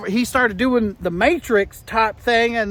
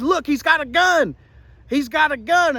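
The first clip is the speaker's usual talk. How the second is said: high-pitched mock alarm call; mock urgent warning; 6 semitones higher than usual. Witness warning security about the gunman, mock urgent warning.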